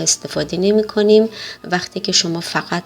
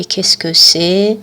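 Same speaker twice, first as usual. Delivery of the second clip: slow French example; much slower than usual. Shown as a demonstration. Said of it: "در زبان محاورهٔ فرانسه به جای".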